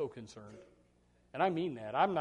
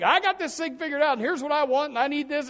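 mock know-it-all; 11 semitones higher, 8 dB louder than usual. Mock self-assured believer.

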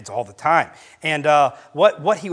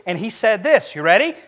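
ordinary delivery

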